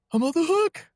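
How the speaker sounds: high, bright squeal of relief; relieved excitement. Retold or imitated. Man retelling his relieved thought, squeaking way up high. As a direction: gush with giddy relief that the baptism moved.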